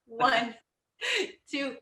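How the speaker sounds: steady fitness coach count; workout counting. Acted. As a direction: count reps for the class. Counting squats with class.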